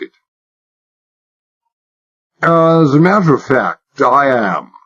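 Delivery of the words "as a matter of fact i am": put-on high-class English accent laid on thick, higher; hammed-up upper-class English drawl; exaggerated British accent. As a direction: play the posh Englishman to the hilt. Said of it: "Far from trying to conceal my British accent, I exaggerated it".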